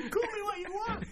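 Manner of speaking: high, laughing cheeky pupil; laughing mock line. Co-host laughs while echoing the pupil's comeback. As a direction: giggle through a cheeky comeback.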